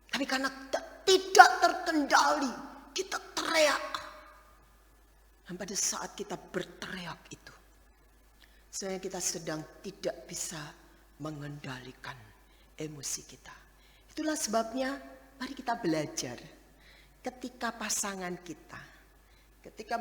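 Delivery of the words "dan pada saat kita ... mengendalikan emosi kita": hushed. Real, not acted. Hushed sermon point.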